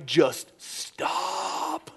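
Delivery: exasperated plea. Retold or imitated. Dad cutting off daughter's apologies.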